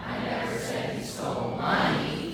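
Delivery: money punched at the end of a slow line; stress on money. Shown as a demonstration. Group practicing one sentence together, stress on money. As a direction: hit the word money hard.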